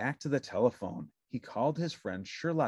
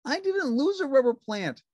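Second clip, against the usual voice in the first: high, bright puppet voice; cheerful little puppet; Ernie voice; 12 semitones higher, 6 dB louder than usual. A narrator's reading. Narrator voicing Ernie answering the phone, high puppet voice.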